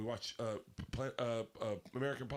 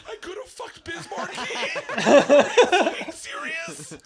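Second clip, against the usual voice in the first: very high, loud squeal of disbelief; starstruck outburst; 17 dB louder than usual. Retold or imitated. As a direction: squeal it through laughter, mock-overwhelmed. Acts out his starstruck gasp.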